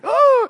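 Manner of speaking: loud, high mock howl of frustration; high-pitched exasperated "ohh"; riled-up squeal. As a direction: let out an imitated kid's outraged wail. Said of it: "it just riles him up".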